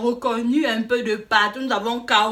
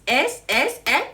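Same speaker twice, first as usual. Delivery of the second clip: ordinary delivery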